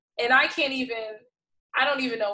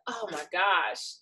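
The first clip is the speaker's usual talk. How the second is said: ordinary talk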